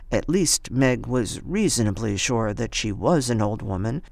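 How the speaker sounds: ordinary delivery, nothing marked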